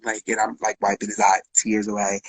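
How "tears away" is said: ordinary talk, nothing marked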